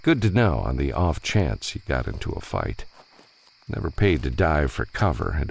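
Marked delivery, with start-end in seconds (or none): none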